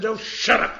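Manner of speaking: loud slurred snap at a stranger; yelling back at the plainclothes cop; drunken snap. Retold or imitated. Drunk woman snapping at a cop, loud.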